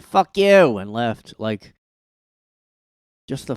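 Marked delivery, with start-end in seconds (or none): 0.0-0.8 blunt fuck you. Retold or imitated. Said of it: "if she just said".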